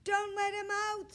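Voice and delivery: put-on high, loud child's scream; girl begging her father not to let the dog out, screaming. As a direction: shriek it at Daddy in panic. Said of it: "screamed Zibby".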